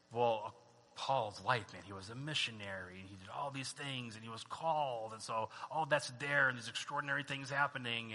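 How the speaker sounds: low, hushed musing; lightly voiced reader thinking it over; musing aloud. Reader reasoning about Paul's missionary life, musing quietly.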